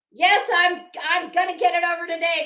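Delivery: loud irritated reply to a pestering dog; exasperated loud retort. Real, not acted. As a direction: shut the dog down fast and loud. Snapping at the barking dog.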